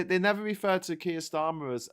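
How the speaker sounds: normal talking voice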